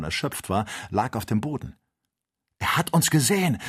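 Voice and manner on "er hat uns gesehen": hoch und laut jubelnd; frohlockend. A narrator's reading. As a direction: ruf es triumphierend aus. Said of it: "rief der Doktor frohlockend". (frohlockender Ausruf)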